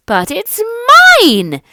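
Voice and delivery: very high, loud, slow scream; a bird screaming her claim. Acted. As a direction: shriek the claim out long. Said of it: "screamed the bird".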